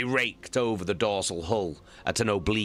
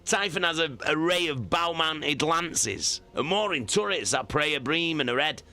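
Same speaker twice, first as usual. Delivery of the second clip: higher, measured naval officer; brief the captain steadily, voice raised in pitch; calm officer voice; 11 semitones higher than usual. Acted. Officer voiced in war story.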